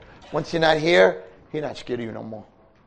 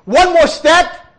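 "One more step" drawn out slowly, much higher and louder; stress on One more step; 9 dB louder, slower than usual. Real, not acted. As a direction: stretch "One more step" to hammer it home. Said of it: "stressed phrase".

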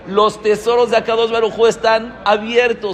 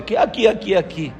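questioning repeat in a near-usual pitch; puzzled echo; faster than usual. Retold or imitated. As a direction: echo the outburst back, not understanding.

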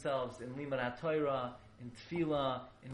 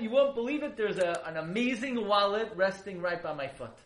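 excited; 6 semitones higher, 8 dB louder than usual. Retold or imitated. Dead father voiced in dream.

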